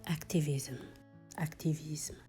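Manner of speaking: usual speaking voice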